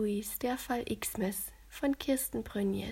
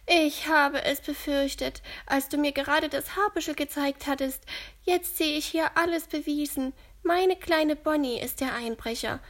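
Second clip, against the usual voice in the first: ältere Dame, etwas höher und lauter gesprochen; bekümmerte alte Frau, leicht gespielt; Gertrud voiced; 5 semitones higher, 6 dB louder than usual. A narrator's reading. Gertrud's voice in the story. (Gertrud gesteht den Kindern, leicht gespielte alte Dame)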